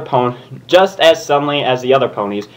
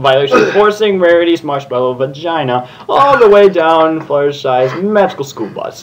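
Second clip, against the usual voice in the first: ordinary delivery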